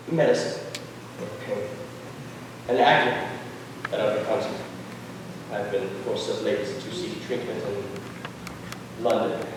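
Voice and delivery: weak, low murmur. Acted. Jack confessing drug use, unwell.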